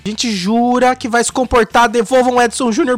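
wild unhinged ranting. Pretend. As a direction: plead frantically for him back. Mock panic, host pleads for cohost.

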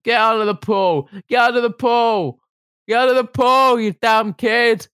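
put-on high yelled orders at a kid; lifeguard shouting warnings. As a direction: shout them out of the pool. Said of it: "slamming him under there".

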